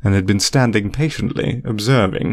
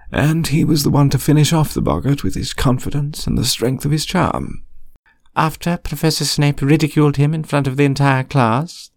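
higher-pitched questioning professor; higher voice, pointedly inquiring; lightly voiced professor questioning; 5 semitones higher than usual. A narrator's reading.

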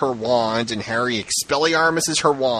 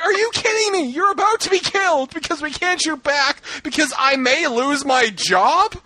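incredulous outburst; 11 semitones higher than usual. Retold or imitated. Ranting to co-hosts about the plot, high exasperated outburst. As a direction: shout your disbelief at the page. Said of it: "I'm like".

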